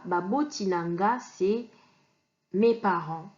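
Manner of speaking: normal talking voice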